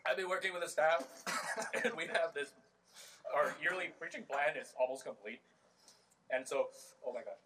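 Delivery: strained and loud, mouth burning; talking through hot sauce pain. Real, not acted. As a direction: push the words out through the burn. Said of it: "I'm very emotional right now".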